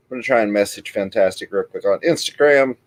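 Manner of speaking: ordinary talk, nothing marked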